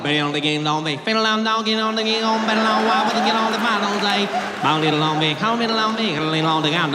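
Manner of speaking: rapid run-together Southern gibberish, sung like a banjo tune; mumbled Southern drawl. Retold or imitated. Southern men arguing over directions, gabbled drawl. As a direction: gabble like two locals arguing in thick drawl. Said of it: "Mumford and Sons concert in their mouth".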